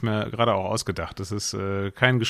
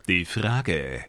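low, slow dramatic title; dramatic announcer; 4 semitones lower, 4 dB quieter, much slower than usual. Acted. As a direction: announce it with gravity.

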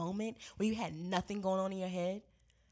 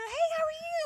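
much higher, sing-song greeting from a chirpy colleague; perky coworker; 5 dB louder than usual. Retold or imitated. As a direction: chirp it like an over-friendly coworker. Coworker greets her cheerily.

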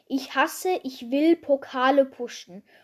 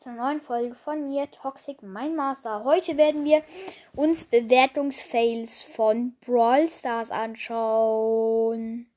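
normal talking voice

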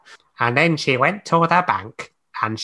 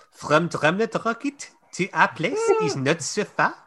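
lightly put-on French accent; 8 semitones higher than usual. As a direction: read it with a hint of French.

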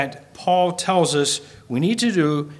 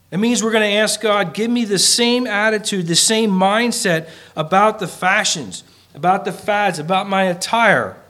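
fervent preaching; 6 semitones higher, 4 dB louder than usual. Acted. Impassioned preaching, raised voice.

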